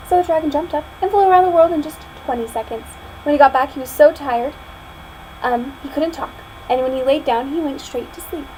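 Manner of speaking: plain reading voice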